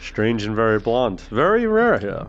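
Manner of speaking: put-on gruff old fisherman. Old sailor talks.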